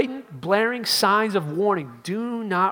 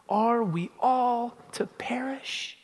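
slow whispered question, higher; hushed despair; 6 semitones higher, much slower than usual. Retold or imitated. The people asking in a slow whispered despair. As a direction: breathe the question out in fear. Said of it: "Look at this open question".

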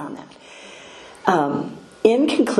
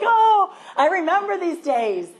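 laughing; 12 semitones higher than usual. Real, not acted. Grandmother laughing at fussing toddler.

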